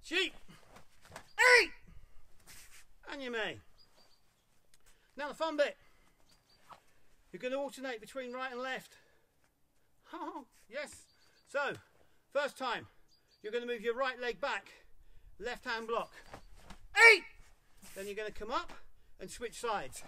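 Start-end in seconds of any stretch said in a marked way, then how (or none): 0.0-0.3 high, loud count call; drill count. Real, not acted. 1.4-1.7 short, explosive kiai; martial shout. Real, not acted. Kiai shout in karate drill. 17.1-17.2 short, explosive kiai; martial shout. Real, not acted. Kiai shout in karate drill.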